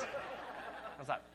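whispered, very soft; embarrassed whisper; hushed aside. Retold or imitated. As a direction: breathe it under your breath. Hushed aside before the slap.

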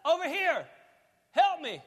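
loud, high acted cry to Jesus; screaming out for help. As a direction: call out like disciples across the water. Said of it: "They start screaming out".

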